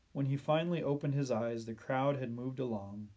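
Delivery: usual speaking voice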